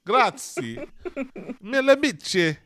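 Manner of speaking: put-on high, loud hammy Italian accent; mock Italian accent. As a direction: overdo the fake Italian accent. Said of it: "some bad Italian in there".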